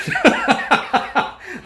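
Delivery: high burst of laughter; real laugh. Real, not acted. Telling how he refused to cage the bird, real laughing. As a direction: crack up mid-story.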